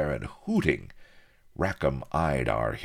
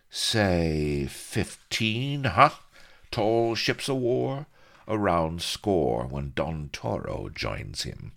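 put-on slow, sly old pirate; 5 semitones higher, slower than usual. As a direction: drag it out like a cagey buccaneer. Pirate Bilbo voiced, slit-eyed.

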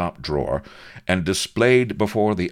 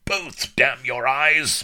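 high, slow roar of fury; bellowed; 9 semitones higher, 3 dB louder, slower than usual. Acted. Admiral bellowing at the shopkeeper, slow furious roar. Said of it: "bellowed Rooke".

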